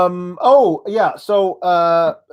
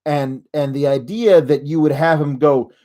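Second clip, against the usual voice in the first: ordinary talk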